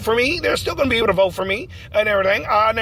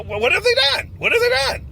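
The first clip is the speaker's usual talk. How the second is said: indignant high outburst; 12 semitones higher than usual. Acted. Candidate challenging rival candidates, pitch shooting up in outrage.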